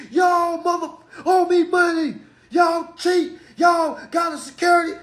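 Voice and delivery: high, hammed-up furious rant; mock angry caller. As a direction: play a hothead yelling at the bank. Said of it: "You think I'm calling them and saying".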